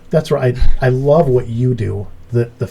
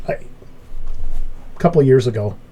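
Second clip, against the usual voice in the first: usual speaking voice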